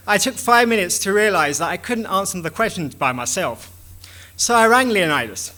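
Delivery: usual speaking voice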